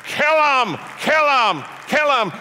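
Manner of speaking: high screamed chant; full-volume, high-pitched yell; screaming. Retold or imitated. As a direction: scream it over and over like a mob. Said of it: "somebody in Ottawa is screaming".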